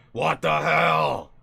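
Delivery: put-on slow, loud old-man grumble, not deep enough yet; first try at the voice, too light. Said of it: "I didn't say it deep enough".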